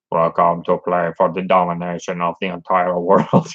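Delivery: lightly done robotic computer voice; a hint of a machine announcer. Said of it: "I love the computerized voice".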